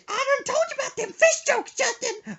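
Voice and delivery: high, loud falsetto warning; mom scolding. Retold or imitated. Mom warning son about fish jokes, high falsetto. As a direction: warn him in a high mom voice.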